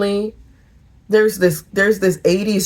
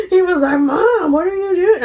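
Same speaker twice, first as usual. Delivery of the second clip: high, laughing through the quote; laughing high retelling; 11 semitones higher than usual. Real, not acted. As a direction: giggle through it. Laughing while retelling son.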